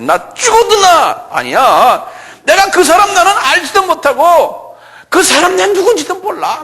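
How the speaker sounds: very high, loud protest; shouted denial. Acted. Peter denies knowing that man, shouting it out. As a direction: yell the denial at top pitch. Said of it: "소리 지르면서 하는 거예요".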